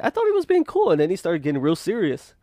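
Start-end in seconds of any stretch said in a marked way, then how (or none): 0.0-0.9 puzzled. Retold or imitated. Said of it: "I was like".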